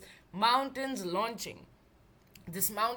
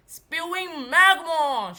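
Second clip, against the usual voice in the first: loud, slow, higher holler; 6 semitones higher, 11 dB louder, slower than usual. A narrator's reading. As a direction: bellow the band name with full force. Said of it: "she hollered".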